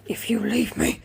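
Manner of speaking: slow whispered warning; half-joking whispered threat. Retold or imitated. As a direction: hiss it as a mock-serious threat. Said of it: "I said".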